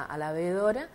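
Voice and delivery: normal talking voice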